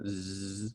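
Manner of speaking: "z" held long and buzzing with the voice. Shown as a demonstration. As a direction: hold a voiced z. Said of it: "if you say the z sound".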